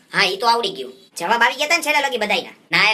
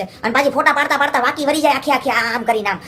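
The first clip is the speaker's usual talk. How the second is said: usual speaking voice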